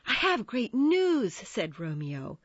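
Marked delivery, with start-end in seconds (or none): 0.1-1.3 high, loud, eager announcement; excited. A narrator's reading. Romeo arrives to tell his friends, excited. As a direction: burst out with the big news.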